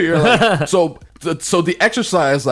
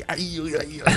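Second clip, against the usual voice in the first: put-on a lightly done Austrian Schwarzenegger accent; Schwarzenegger impression; 6 semitones higher, 5 dB quieter, faster than usual. Mocking Conan's joke, a hinted Austrian Schwarzenegger accent. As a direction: grunt it in a mock Austrian accent. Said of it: "go like".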